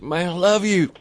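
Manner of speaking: high, enthusiastic quoted voice; gushing fan. Retold or imitated. Judge greeting his favorite radio host, gushing. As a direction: gush it like a devoted listener.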